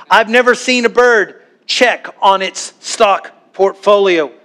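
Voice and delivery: ordinary talk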